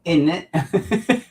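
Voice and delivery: drawn-out posh English tease; English accent. Shown as a demonstration. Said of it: "My English accent".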